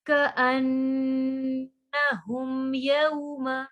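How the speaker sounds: slow, drawn-out tajweed recitation; Quran recitation. Shown as a demonstration. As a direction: recite it with ghunna on the noon. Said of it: "मीम को हल्का सा सिर्फ हमने touch देना है". (धीमी तजवीद वाली तिलावत)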